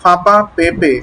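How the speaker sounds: "ফাঁপা পেঁপে" with nasal first vowels; nasalised "ফাঁপা পেঁপে"; from the nose. Shown as a demonstration. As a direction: model "ফাঁপা পেঁপে" word by word through the nose. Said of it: "নাক থেকে".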